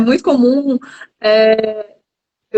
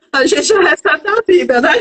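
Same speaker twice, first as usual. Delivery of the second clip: higher-pitched, talking through real laughter; laughing; 4 semitones higher than usual. Real, not acted. Psychologist joining a joke about being born, laughing.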